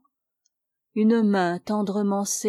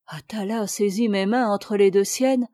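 plain reading voice

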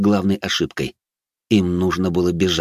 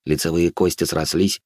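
ordinary delivery, nothing marked

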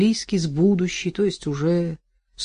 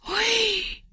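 high, startled cry; shocked gasp. Acted.

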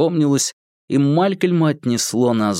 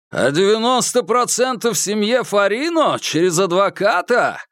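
shouting; 9 semitones higher than usual. Acted. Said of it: "прокричал Курц".

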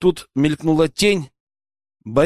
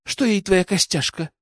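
higher, dismissive scoff; 4 semitones higher than usual. A narrator's reading. Dina dismissing the boyar's tusk as useless, scoffing. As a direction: brush off his precious trophy. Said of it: "хмыкнула Дина".

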